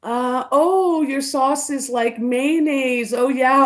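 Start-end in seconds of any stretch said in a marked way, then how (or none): none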